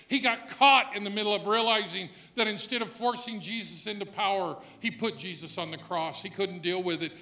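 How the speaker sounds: loud, rising preacher's cry; full-force preaching. Acted. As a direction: preach it out to the back pew. Preacher on Judas's sorrow.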